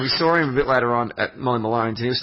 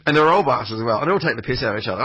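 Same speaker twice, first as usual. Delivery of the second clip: ordinary talk, nothing marked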